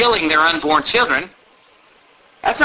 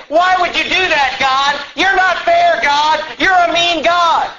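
mock whiner; 8 semitones higher, 3 dB louder, slower than usual. Whiner accusing God continues.

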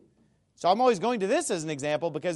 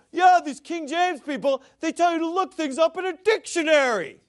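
higher, mocking voice of critic; mocking imitation; 8 semitones higher, 4 dB louder than usual. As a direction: imitate him sneeringly. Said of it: "he's like".

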